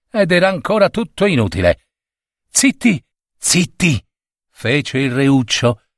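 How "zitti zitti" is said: excited hushing. A narrator's reading. Little prince hushes the court.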